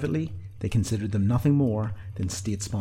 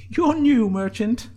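high, loud teasing; Sinead's grinning voice; 14 semitones higher, 6 dB louder, much slower than usual. A narrator's reading. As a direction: tease with a grin, pitched high. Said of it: "grinned Sinead".

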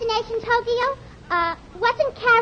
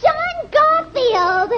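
higher, louder, slow star-struck cry; excited cry; 8 semitones higher, 3 dB louder, much slower than usual. Acted. Fan shouting the actor's name on recognising him, excited. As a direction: call his name out loud in delight. Woman spots the film star.

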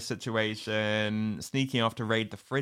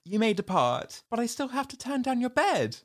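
haughty; 12 semitones higher than usual. Retold or imitated. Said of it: "Harriet's like".

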